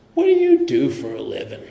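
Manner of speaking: lightly imitated sarcastic needling. Sarcastic guy questioning a newcomer at a men's meeting, mock sarcastic. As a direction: put on a dry, mildly mocking question. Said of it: "kind of a sarcastic guy".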